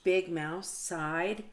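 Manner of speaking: ordinary delivery, nothing marked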